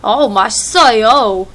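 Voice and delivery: put-on loud, fast joke American accent in Korean; mock American accent. Mocking Americans who speak Korean: loud, fast American accent. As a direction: ham up a clumsy American accent. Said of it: "I'll do it as a joke".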